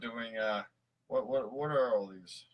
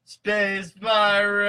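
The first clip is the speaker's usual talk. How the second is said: very slow, loud, high proclamation; dramatic exclamation; 10 semitones higher, 13 dB louder, much slower than usual. Pretend. Host echoing the cohost in a huge dramatic boom. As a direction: boom it out like a movie trailer.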